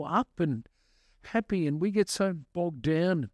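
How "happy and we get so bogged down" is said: ordinary delivery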